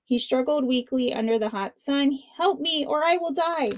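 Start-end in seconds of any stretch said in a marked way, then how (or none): 2.4-3.7 a touch of fear, raised higher; pleading. A narrator's reading. Stranded shark begging Punia for help, higher and pleading. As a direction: beg for help, mildly scared. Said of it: "growing afraid".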